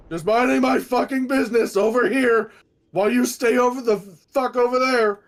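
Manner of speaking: ordinary delivery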